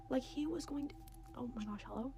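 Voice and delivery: soft, near-whispered realization; hushed disbelief. Real, not acted. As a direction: drop to a hushed, shaken aside. Shaken aside on danger.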